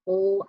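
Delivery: "O" said as a lone dark L, like "ull"; isolated L sound. Shown as a demonstration. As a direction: sound out the final L as "O". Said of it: "hear the O sound".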